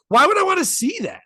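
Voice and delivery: incredulous. Retold or imitated. Incredulous retort to the doctor.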